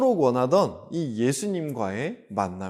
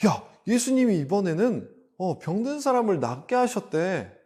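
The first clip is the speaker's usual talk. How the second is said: high, animated gossip voice; excited rumour; 8 semitones higher than usual. Acted. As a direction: share astonishing news with a neighbour. Acting out townspeople passing a rumour. (신나서 높은 목소리로 소문을 전하듯)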